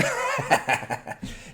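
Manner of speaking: high burst of laughter. Real, not acted. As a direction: break into laughter. Laughing mid stream.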